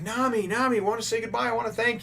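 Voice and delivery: high pleading calls; calling after. Retold or imitated. Villagers calling after Nami as she runs past, pitched high. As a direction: call after her, high, wanting to thank her.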